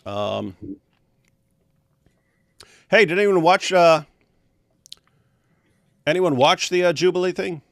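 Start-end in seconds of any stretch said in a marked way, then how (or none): 2.9-7.6 calling out to chat. Real, not acted.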